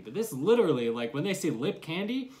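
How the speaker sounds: ordinary talk, nothing marked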